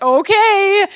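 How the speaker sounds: mockingly going along with it. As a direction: humour them with an exaggerated sing-out. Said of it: "And I'm mocking this".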